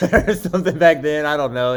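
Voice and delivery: amused, loud and laughing; real laughing. Real, not acted. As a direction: let a laugh burst through the aside. Laughs at own aside about girlfriend.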